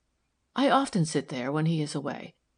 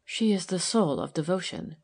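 plain reading voice

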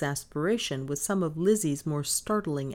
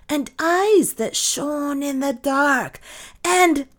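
building louder and higher into slow, lurid emphasis; heated storytelling; 6 semitones higher, 6 dB louder than usual. A narrator's reading. Maid describing the intruder to mistress, mounting drama. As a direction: pile up the horrors, stretching each one.

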